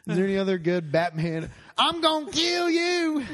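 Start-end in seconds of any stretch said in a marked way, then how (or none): 1.8-3.2 high, loud slow twang; drawn-out Southern pop star; [name] voice. Pretend. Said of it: "something she'd say".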